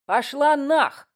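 loud, high, drawn-out brush-off; curt dismissal, acted. A narrator's reading. Man swears at Katya while leaving, dismissive. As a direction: send them packing with slow emphasis. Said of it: "обронил мужик на ходу".

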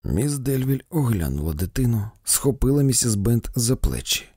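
ordinary delivery

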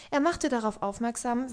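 usual speaking voice

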